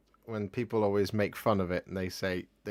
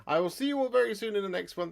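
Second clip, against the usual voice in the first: put-on outro voice; 12 semitones higher, 4 dB louder, faster than usual. Comic sign-off voice.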